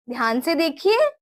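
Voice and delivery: usual speaking voice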